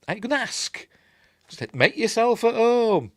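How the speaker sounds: high, squeaky mock scolding; high-pitched protest, slowing on the sarcastic invitation; mock indignation. Scolding kids who raid the fridge, high mock indignation.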